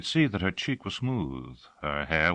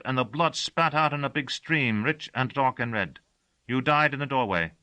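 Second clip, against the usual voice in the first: a simple servant recounting a killing he witnessed; servant Gossagor's voice; 8 semitones higher than usual. Acted. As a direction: tell the murder cheerfully, like a routine report.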